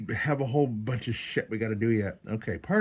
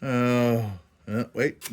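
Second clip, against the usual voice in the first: ordinary talk, nothing marked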